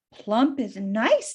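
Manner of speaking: slow, low purr of approval; the fox coaxing Winnie, slow; sly fox. Acted. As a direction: draw it out slowly and sly. Fox voiced in read story.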